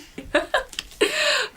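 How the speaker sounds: soft high-pitched giggle. Real, not acted. Laughs at baby.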